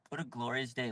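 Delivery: contented hushed afterthought; soft echo. Real, not acted. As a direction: murmur it again to yourself.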